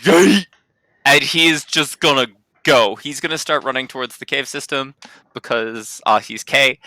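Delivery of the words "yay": higher, louder burst of mock delight; character's cheer. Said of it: "Kay's gonna be like".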